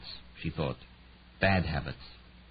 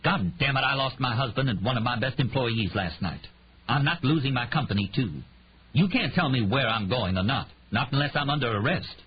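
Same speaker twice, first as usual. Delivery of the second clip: snapping angrily; 6 semitones higher than usual. A narrator's reading. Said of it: "She snapped".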